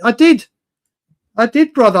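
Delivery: triumphant realization. Real, not acted. Finds the message.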